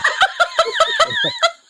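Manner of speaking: squealing high laugh; high laughter. Real, not acted. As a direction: burst of high-pitched laughter. Laughs at bad dub story.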